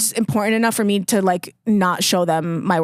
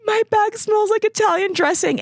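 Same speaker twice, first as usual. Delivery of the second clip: high tearful wail; imitating her own breakdown; mock sobbing complaint; retold crying; 12 semitones higher than usual. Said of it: "And I was just like".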